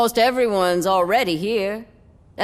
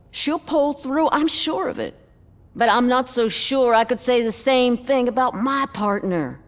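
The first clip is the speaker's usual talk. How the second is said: normal talking voice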